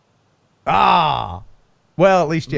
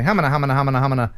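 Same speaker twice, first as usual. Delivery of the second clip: auctioneer voice; 4 semitones lower, faster than usual. Pretend. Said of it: "Do your auctioneer voice there".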